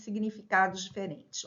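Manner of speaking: usual speaking voice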